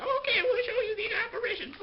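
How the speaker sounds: put-on high, quavering old witch. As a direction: squeak it like a cackling crone. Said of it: "and they're like".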